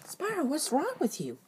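normal talking voice

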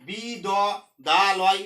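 "বি দ" as two drawn-out open syllables, then "দা লয়"; wrongly split syllables. Shown as a demonstration. Teacher showing what not to say, wrongly split syllables. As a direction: split "বিদ" into "বি" and "দ". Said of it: "এখানে যদি আমি বলতাম".

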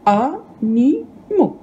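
"Animaux" very slowly, syllable by syllable; split into syllables. Shown as a demonstration. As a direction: break "Animaux" into three drawn-out, separate syllables. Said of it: "elle est segmentée en syllabes". (« Animaux » découpé lentement en trois syllabes)

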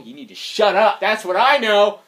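mock-angry comeback; fed-up retort. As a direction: tell them off, rising to a peak. Snapping back at the annoying talkers.

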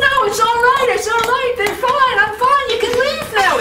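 very high, strained squeak. A narrator's reading. Anansi in pain urging neighbors to leave, very high voice. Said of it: "His voice was getting higher and higher".

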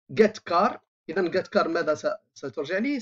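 ordinary delivery

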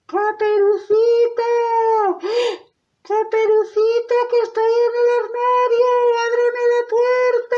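high, loud granny voice; grandmother calling out. Acted. Grandmother shut in the wardrobe, high urgent calling. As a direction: call high and loud to be let out. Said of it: "la abuelita desde el armario".

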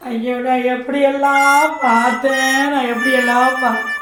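hammed-up lament, dragging out the words; mock crying. Devoted fan wails about being left out, mock crying. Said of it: "பாவம், என்னமோ அழுகுறாங்க".

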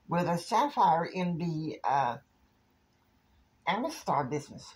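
high-pitched put-on woman's voice; Kristen. Kristen telling J R she has other plans, high voice. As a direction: pitch it high, turning down the invitation. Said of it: "And Kristen's like".

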